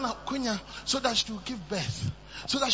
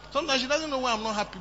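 ordinary talk, nothing marked

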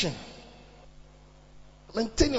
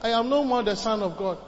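loud, raised-pitch sermon delivery; impassioned preaching; 6 semitones higher, 5 dB louder than usual. Acted. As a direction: preach it forcefully to the congregation. Preaches at full force.

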